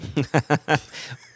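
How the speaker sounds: a quick embarrassed laugh before trying an accent. Real, not acted. Laughing before a failed impression for his co-host. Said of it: "in the accent of Bruce Russell".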